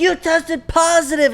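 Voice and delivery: high-pitched accusing girlfriend impression; imitating an angry heckling girlfriend; mock yelling. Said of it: "she's, like, yelling at him".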